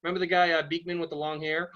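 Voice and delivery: usual speaking voice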